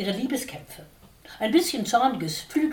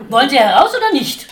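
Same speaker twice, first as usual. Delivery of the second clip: very loud, higher, fast demand; furious shout; 6 semitones higher, 13 dB louder, faster than usual. A narrator's reading. Dickhop roars his last demand at the sparrow. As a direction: roar the ultimatum.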